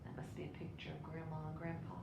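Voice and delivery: ordinary delivery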